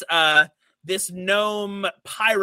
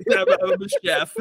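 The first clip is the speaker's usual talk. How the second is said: high, quick little voice; a tiny cameraman blurting out his job; squeaky fast reply; 4 dB louder, much faster than usual. Acted. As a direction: rattle it off in a squeak.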